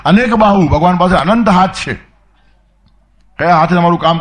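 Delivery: high, loud preaching swell; rising fervour. Real, not acted. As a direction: lift the voice to proclaim it.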